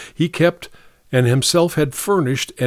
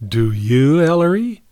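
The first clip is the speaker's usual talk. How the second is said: sweetly; 4 semitones higher than usual. A narrator's reading. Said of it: "she added sweetly".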